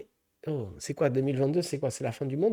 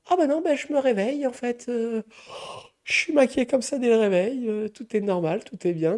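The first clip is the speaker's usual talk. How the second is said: put-on much higher, innocent made-up girl; mock girly voice; 8 semitones higher than usual. Mocking a made-up girl insisting it's natural, higher and innocent. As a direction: insist innocently she wakes up like this. Imitates girl defending her makeup.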